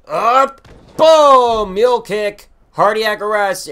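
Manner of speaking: loud, high hype shouts; excited shout. Real, not acted. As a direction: shout the combo in triumph. Hyped wrestling combo.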